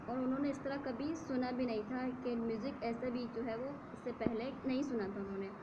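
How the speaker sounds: normal talking voice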